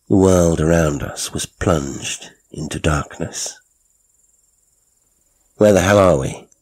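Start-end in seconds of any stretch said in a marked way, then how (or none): 5.6-6.5 louder, sharp angry demand; snapped. A narrator's reading. Narrator in the dark lorry, snapping at the driver. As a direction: snap it out, rattled and furious. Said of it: "I snapped".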